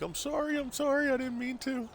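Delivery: put-on very high, pleading girl voice; pleading daughter. Daughter apologizing to dad for farting in car, high pleading voice. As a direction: beg forgiveness in a squeaky kid voice.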